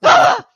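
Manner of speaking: very high, loud mock scream; startled scream. Storyteller re-enacting waking to a truck horn, high scream. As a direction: shriek as if jolted awake in terror.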